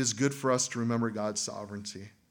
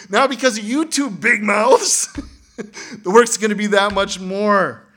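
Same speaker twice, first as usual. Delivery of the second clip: angry shout; 9 semitones higher, 11 dB louder than usual. Acted. Israelites blaming the two leaders.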